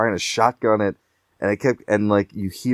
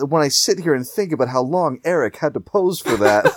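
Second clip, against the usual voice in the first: Pagan impression; 6 semitones higher than usual. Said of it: "He's like".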